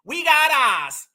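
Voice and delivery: "We got eyes" slowed right down in a mocking Massachusetts accent; drawn-out Massachusetts accent mockery; exaggerated Massachusetts accent. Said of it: "Listen to the Massachusetts accent".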